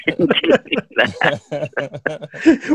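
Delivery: amused, words broken up by laughter; laughing. Real, not acted. Actress joking to interviewers about avoiding travel, laughing. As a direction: laugh your way slowly through the line.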